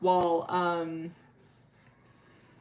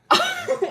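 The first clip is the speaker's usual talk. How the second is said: sudden squeaky laugh; short shrill giggle; high laugh burst; 8 dB louder than usual. Real, not acted. Vlogger bursting into a high giggle.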